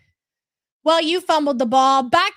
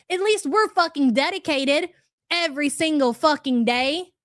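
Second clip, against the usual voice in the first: ordinary talk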